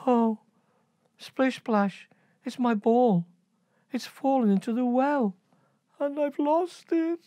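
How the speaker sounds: put-on high, pleading girlish voice; high whiny princess; upset princess.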